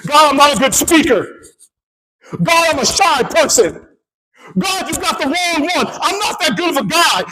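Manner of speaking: high-pitched, driving sermon storytelling; impassioned preaching. Real, not acted. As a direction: preach the Bible dialogue at full force.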